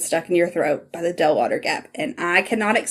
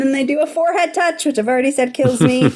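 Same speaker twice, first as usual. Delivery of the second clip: laughing shout; 6 semitones higher, faster than usual. Real, not acted. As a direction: laugh it out, overwhelmed with delight. Fangirling over forehead touch.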